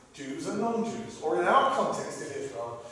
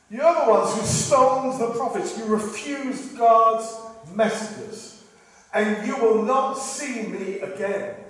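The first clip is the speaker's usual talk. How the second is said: loud, raised-pitch accusation; forceful, high, slowing into a solemn pronouncement; preached rebuke; 5 semitones higher, 5 dB louder, slower than usual. Acted. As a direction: proclaim a stern reproach from the pulpit. Preacher voices the Lord rebuking Jerusalem.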